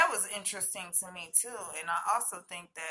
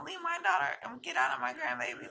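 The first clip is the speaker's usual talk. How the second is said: mildly heated, higher and fast; quick, high-pitched mock scolding; imitated telling-off; 7 semitones higher, faster than usual. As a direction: imitate a grandmother telling off an intruder. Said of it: "kinda almost cussing this thing out".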